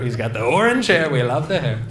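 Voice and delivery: ordinary talk, nothing marked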